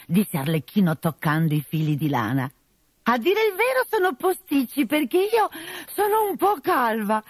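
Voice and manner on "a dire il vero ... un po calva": high, coy falsetto of a man posing as a lady; disguised bride voice. Acted. Arlecchino in disguise, flirting with the captain, high and coy. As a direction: play the fake bride, coy and sweet.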